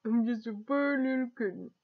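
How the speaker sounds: high filtered creature voice; squeaky creature filter; monster voice. Shown as a demonstration. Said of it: "the monster app".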